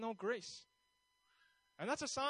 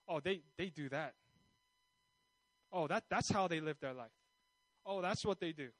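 lowered, understated disapproval; judgmental; 5 semitones lower than usual. Acted. Churchgoer privately judging other people, understated disdain. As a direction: look down on them, pitched low. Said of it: "you're judging someone in your heart".

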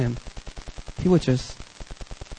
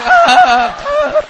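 put-on high, loud slurred drunk girl; 13 semitones higher, 8 dB louder than usual. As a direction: whine it like a tipsy friend. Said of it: "And she's like".

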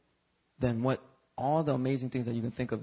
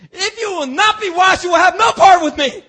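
high, loud, grand pronouncement; dramatic Jesus; 15 dB louder than usual. Retold or imitated. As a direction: declare it with booming drama. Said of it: "You wanna get dramatic?".

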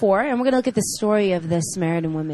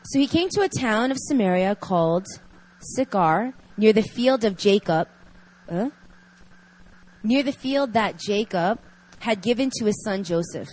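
reading scripture; 4 dB louder than usual. Acted. Reads scripture passage aloud.

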